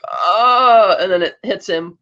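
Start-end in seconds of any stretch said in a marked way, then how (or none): 0.1-0.9 higher, louder mock yell; imitated villain scream. Trevelyan as the structure falls on him, mock scream. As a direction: let out a doomed mock cry. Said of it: "Trevelyan's like".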